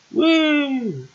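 high, drawn-out woo; playful high squeal of woo; high whoop. Real, not acted. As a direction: let out a long high-pitched whoop. Cheer while playing.

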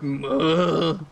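imitating a zombie moan; zombie groan. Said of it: "It sounded like a zombie. It was like".

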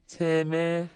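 usual speaking voice

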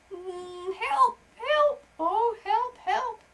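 high wailing cries for help, loud; a trapped wasp wailing help, help; crying for help. Acted. Wasp trapped at window wails loud and high for help. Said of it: "heard another voice going".

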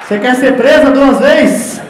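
loud, laughing hush to mom; exasperated shout. Retold or imitated. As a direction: shout her down while cracking up. Son hushes mother, laughing.

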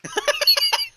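very high squeal of laughter. Real, not acted. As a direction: shriek with laughter. Both aim guns at him.

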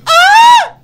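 very high, loud shriek; short piercing scream of fright; startled scream. Real, not acted. Player jumped by the creature, startled scream.

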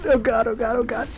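repeated high panicked cries. Real, not acted. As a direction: keep crying out in panic.

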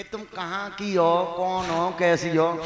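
lightly put-on woman voice, slowly; man doing a woman's voice. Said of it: "वो कहेगी".

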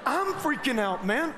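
usual speaking voice

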